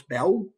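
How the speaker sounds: "bell" ending in a rounded W instead of L; W sound for L. Shown as a demonstration. Teacher repeats the Cockney example, W sound for L. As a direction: say "bell" as "bew". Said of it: "instead of bell with an L".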